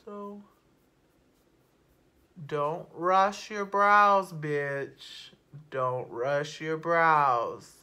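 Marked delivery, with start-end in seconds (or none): 2.5-7.7 loud, drawn-out "rush" in "don't rush your brows"; slow, punchy warning, leaning hard on "rush"; emphatic warning. Real, not acted. Makeup vlogger warns viewers about brows, emphatic and drawn out.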